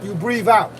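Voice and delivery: usual speaking voice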